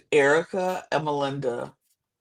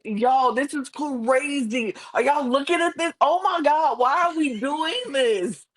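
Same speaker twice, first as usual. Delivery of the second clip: retold yelling; 10 semitones higher than usual. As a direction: appeal to everyone in disbelief. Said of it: "just yelling".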